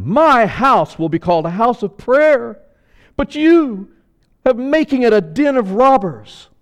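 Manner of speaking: loud, high, ringing rebuke; Jesus rebuking. Acted. Jesus rebukes the temple sellers in a loud, high voice. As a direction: thunder it at the money changers. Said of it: "he said to them".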